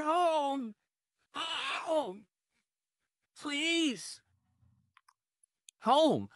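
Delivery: insistent calling. Acted. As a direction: keep hammering the door. Pounding on the door repeatedly.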